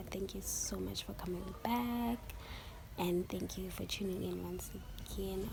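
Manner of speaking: hoarse just-woke-up whisper; raspy morning whisper. Real, not acted. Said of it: "excuse my voice".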